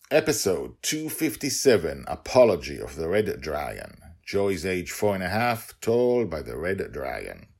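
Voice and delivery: loud, bright title announcement; announcer intro. Acted. Host announcing episode to listeners, loud and upbeat.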